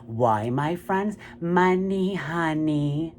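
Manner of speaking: ordinary talk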